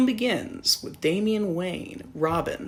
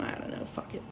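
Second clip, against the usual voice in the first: ordinary talk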